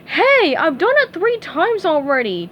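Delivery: put-on high, loud indignant girl voice; indignant declaring. A narrator's reading. Tina protests to the teacher, high and loud. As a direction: protest with fins on hips. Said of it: "Tina declared".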